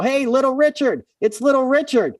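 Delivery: high-pitched excited fan call; New York crowd. Retold or imitated. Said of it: "the regular New York crowd came out".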